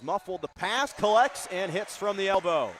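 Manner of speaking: rising to a loud, high scoring call; excited play-by-play. Real, not acted. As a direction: build through the pass to the made shot. Live call of a made shot.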